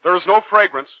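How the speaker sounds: desperate denial climbing to a shout; rising frantic denial. Acted. Giovanni denies in rising panic.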